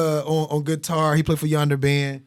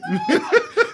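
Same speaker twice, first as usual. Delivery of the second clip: squealing laugh, pitched way up; high burst of laughter. Real, not acted. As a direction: break into a high cackle. Loud laugh after the quote.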